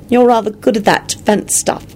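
a hint of flirting in the praise; almost flirtatious. A narrator's reading. Elizabeth half-flirting as she praises Lupin's defense work, lightly teasing. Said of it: "she said almost flirtatiously".

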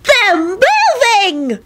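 very high, stretched-out squeal; happy yelp. A narrator's reading. Natalie seeing bodies move, happy squeal. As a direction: draw it out in wonder and glee. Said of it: "Natalie yelped happily".

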